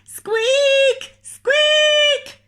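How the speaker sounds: very high, loud squeaking mouse. Acted. Noisiest mice squeaking at each other, very high and loud. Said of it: "the noisiest mice".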